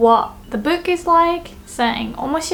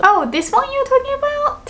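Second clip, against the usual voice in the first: much higher questioning voice; surprised realisation; 14 semitones higher than usual. Acted. Listener who misheard, acted surprised question. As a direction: jump up in pitch on the mistaken guess. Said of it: "that person thought".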